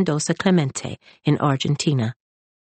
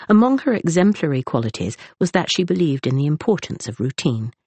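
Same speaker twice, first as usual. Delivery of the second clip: plain reading voice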